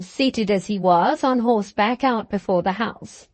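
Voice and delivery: ordinary delivery, nothing marked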